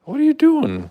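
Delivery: high, loud shocked squeal; startled pet talk. Real, not acted. Owner catching cat eating string, high loud squeal.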